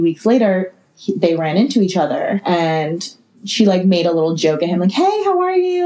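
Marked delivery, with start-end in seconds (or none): 4.9-5.9 high, sweet fake-friendly greeting; sugary nice greeting. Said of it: "Like, being nice".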